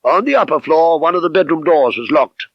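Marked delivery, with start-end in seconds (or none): none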